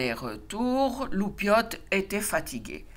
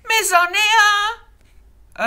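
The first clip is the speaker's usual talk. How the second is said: excited insistence; 13 semitones higher, 8 dB louder, much slower than usual. A narrator's reading. Loupiote insists to papa.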